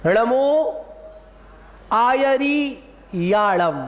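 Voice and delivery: आयरियाड़म very slow, every vowel drawn out; firm णमो, pause, then stretched आयरियाड़म; clear full pronunciation. Shown as a demonstration. Teacher modelling the mantra line, slow and clear आयरियाड़म. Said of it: "पूरा शब्द clear होना चाहिए".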